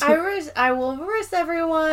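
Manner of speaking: high-pitched caricature voice; Ping. Retold or imitated. Co-host joins in imitating the goodbye character, high caricature. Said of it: "And we get Ping".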